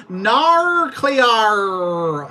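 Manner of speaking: loud, high, drawn-out Aussie wail; Australian accent. Retold or imitated. Mermaid girl in a show calls Cleo, loud Australian accent. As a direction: call out in exaggerated Australian.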